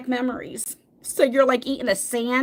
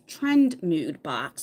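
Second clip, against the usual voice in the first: very slow, lower Trend Mood box; said slowly; 6 semitones lower, much slower than usual. Shown as a demonstration. Vlogger slows down so her mind processes the name, said slowly. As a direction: spell it out word by word, deliberately. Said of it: "So I have to say it slow".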